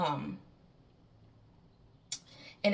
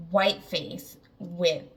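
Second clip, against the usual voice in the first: ordinary delivery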